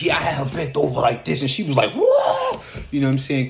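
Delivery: loud, high-pitched mock stand-up bit; hammed-up prop comic. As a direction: imitate an over-the-top physical comedian, building to a yelled whoa. Mimics crude prop comedian's act.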